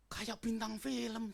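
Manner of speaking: impressed flattery. Retold or imitated. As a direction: gush in mock awe at the youth. Flattering a youth, retold. (pujian kagum yang diulur)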